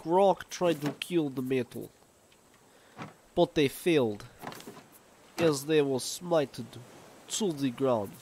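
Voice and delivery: high, loud, hammed-up fake Albanian accent taunting the shark; dumb mock Albanian accent. Gamer taunting a shark in a mock Albanian accent. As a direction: ham up a bad Albanian accent, slow and boastful. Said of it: "I need to stop doing this dumb accent".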